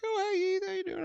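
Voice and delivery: high sugary mom greeting, imitated; mock mom voice. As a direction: play the friend's mom, chirpy and high.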